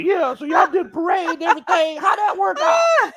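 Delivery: high, shouted mimicry of doubters; mocking hecklers. Imitating everybody questioning the parade, high mocking shout. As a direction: squawk the question loudly, mocking whoever asked it. Mocks what everybody keeps asking.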